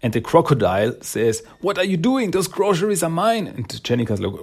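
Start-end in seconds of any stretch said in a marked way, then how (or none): 1.6-3.5 high-pitched, put-out complaint; mock outrage, pitched way up; indignant protest. Crocodile protests lost groceries.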